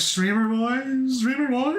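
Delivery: high, stretched-out disbelief; slow, high, mock-outraged question; incredulous echo. Real, not acted. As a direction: echo it back in rising disbelief. Incredulous echo of a chat message.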